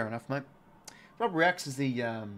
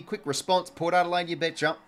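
excited goal call; 4 semitones higher, 6 dB louder than usual. Real, not acted. Live goal call for Port.

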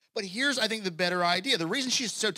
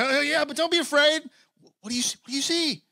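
high-pitched, hurried-sounding acted reassurance; flustered king; 9 semitones higher, 5 dB louder than usual. King to the frightened woman asking what she sees, flustered. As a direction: blurt it out high, as a rattled king.

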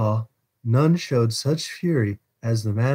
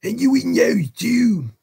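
high, raised ruffian's question; tense, high-pitched questioning; urgent demand; 13 semitones higher, 3 dB louder than usual. A narrator's reading. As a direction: call out sharply to check. Sykes urgently checking the house.

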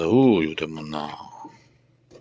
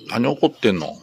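higher, louder coaxing to an angry pet; 4 semitones higher, 7 dB louder than usual. Real, not acted. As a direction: coax the grumpy pet sweetly. Pet owner baby-talk to angry pet.

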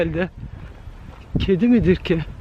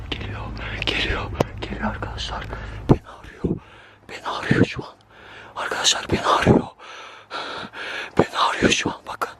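tense breathy whisper while hiding; scared whisper; 7 dB quieter than usual. Real, not acted. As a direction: whisper so it won't hear you. Hiding behind tree from pursuer. (saklanırken korkulu fısıltı)

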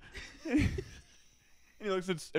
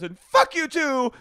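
high, loud, slow hammed-up curse; mock fury; 12 semitones higher, 8 dB louder, slower than usual. Star cursing at Andy, loud slow mock fury. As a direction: spit it at him slowly. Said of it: "he goes".

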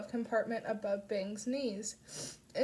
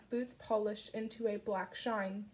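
ordinary delivery, nothing marked

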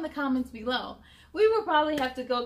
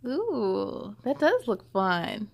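ordinary delivery

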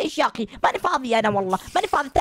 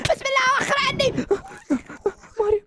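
higher, frantic alarmed calls; high, rattled cries; panicked; 7 semitones higher than usual. Acted. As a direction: yell at the bugs to get away. Still fighting the bugs.

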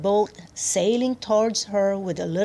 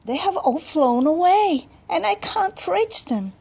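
put-on high, louder little-girl voice; distressed plea; 9 semitones higher, 4 dB louder than usual. A narrator's reading. Girl answering the angry cat, distressed high voice. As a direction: answer pleadingly, pitched up.

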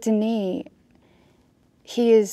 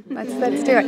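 louder, eager let's do it; eager yes; 5 semitones higher, 6 dB louder than usual. Retold or imitated. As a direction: seize the chance. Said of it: "and I said".

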